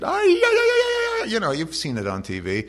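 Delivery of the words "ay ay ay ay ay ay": very high, loud Middle Eastern ululation; imitated mourners' wail. Preacher imitating professional mourners at a death, high ululating wail. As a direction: wail like a hired mourner, hammed-up. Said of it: "do the Middle Eastern".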